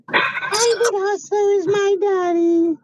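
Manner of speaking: high, slow cartoon mouse voice; Minnie Mouse voice. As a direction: voice the dog as a tiny cartoon mouse, slowly. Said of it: "That was the worst impression of Minnie Mouse ever".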